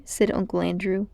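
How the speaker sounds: usual speaking voice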